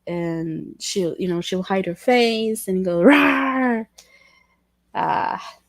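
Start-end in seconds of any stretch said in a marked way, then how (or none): 3.0-3.9 monster rawr. Retold or imitated. Mom's monster game, hiding face.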